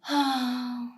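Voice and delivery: long disappointed sigh; deflated sigh at a meagre dinner; weary sigh. A narrator's reading. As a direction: let out a heavy sigh over porridge. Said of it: "The woodcutter sighed".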